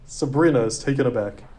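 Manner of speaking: ordinary delivery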